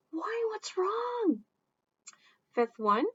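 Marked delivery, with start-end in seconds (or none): 0.1-1.4 high worried question; concerned reply. Acted. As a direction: ask gently what's wrong. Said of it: "the one that's responding".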